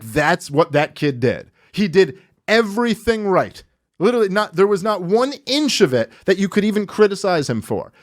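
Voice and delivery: ordinary talk, nothing marked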